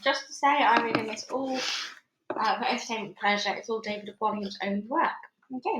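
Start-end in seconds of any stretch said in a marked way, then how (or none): none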